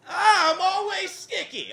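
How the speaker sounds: very high, drawn-out whiny bush; talking bush. Acted. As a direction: wail slowly as a silly high-voiced bush. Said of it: "my impression of a bush in a public park".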